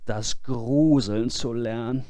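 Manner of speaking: ordinary delivery, nothing marked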